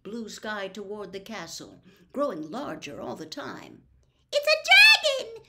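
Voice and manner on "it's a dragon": very high, loud panicked shriek. A narrator's reading. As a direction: lose your head completely. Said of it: "shrieked one of the first years".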